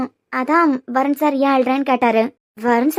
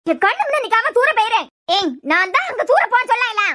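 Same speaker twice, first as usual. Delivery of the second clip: higher-pitched irritated scolding; angry dismissal; 6 semitones higher than usual. Acted. Woman orders child out of her sight, angry and high. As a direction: send the child away, exasperated.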